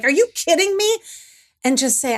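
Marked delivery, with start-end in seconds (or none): 0.0-1.0 high, incredulous "are you kidding me"; imitating the angry comeback she held back; mock outraged retort. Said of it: "scream back and be like".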